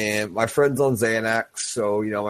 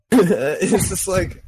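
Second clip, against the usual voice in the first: slow words through real laughter; laughing; 6 semitones higher, much slower than usual. Real, not acted. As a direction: laugh while getting the words out. Laughing at own story.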